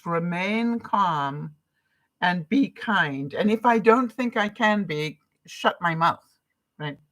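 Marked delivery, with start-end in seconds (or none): 0.1-3.3 slow, deliberate mantra; calm, measured motto. Shown as a demonstration. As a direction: say it like a personal motto, slowly. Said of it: "my new mantra".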